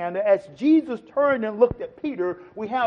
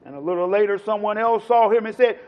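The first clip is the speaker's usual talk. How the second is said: normal talking voice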